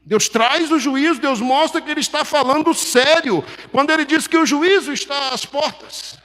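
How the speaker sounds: loud, high-pitched pulpit warning; forceful preaching. Real, not acted. Preacher warning that God is serious about judgment, forceful preaching.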